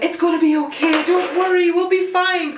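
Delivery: high, anxious reassurance; reassuring. Retold or imitated. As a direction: soothe a panicking friend. Sophie calming her before the crash.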